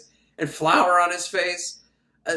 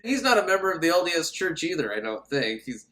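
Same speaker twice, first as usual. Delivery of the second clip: ordinary delivery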